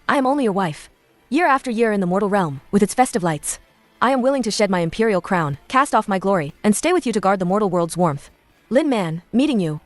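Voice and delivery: usual speaking voice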